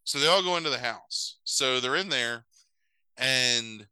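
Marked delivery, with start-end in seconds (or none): none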